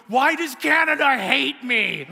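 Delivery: high, loud, drawn-out wail of complaint; hammed-up cry against the universe; over-the-top outrage. Man pouting in his car, over-the-top outrage. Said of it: "I was just over top".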